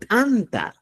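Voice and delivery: ANTA begun with a popped ejective t; explosive t. Shown as a demonstration. Teacher saying Cusco's word for bread, explosive ANTA. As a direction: burst the tongue off the teeth into ANTA. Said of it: "Escucha bien".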